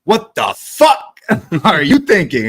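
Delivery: high, loud, drawn-out scolding; mock yell. Mom yells at the kid in the car, mock outrage. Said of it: "she yells".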